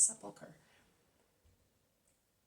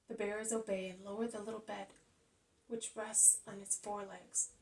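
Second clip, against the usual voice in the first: ordinary delivery, nothing marked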